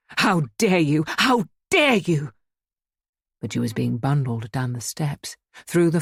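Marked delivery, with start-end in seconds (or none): 0.1-1.0 outraged. A narrator's reading. Outraged repeated protest. 1.1-2.3 each word drawn out, higher; slower, higher outrage. A narrator's reading. Outraged repeated protest.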